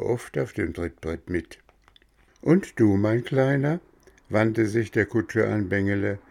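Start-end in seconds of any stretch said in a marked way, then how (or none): 2.4-3.8 higher, sweet coaxing voice; coaxing coachman. Acted. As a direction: lure the boy in gently. Coachman turns to Bengele.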